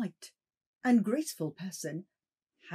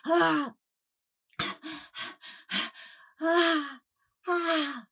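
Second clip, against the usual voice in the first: terrified cries; 9 semitones higher, much slower than usual. Acted. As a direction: cry out in terror. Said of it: "crying out".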